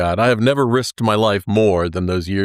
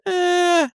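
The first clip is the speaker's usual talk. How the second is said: mock groan. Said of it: "I'm like".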